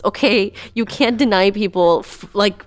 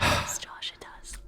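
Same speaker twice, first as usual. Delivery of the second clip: hushed whispered yes, Josh; 15 dB quieter than usual. Acted. Playfully whispering agreement to Josh, hushed. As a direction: breathe it like a secret.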